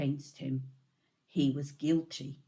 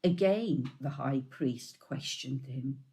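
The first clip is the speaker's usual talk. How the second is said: usual speaking voice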